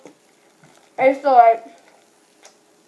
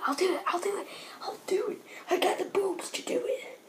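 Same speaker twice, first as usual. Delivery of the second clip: whispering; 9 semitones higher, 10 dB quieter than usual. Real, not acted.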